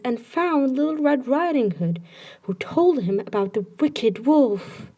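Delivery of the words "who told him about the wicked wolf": ordinary delivery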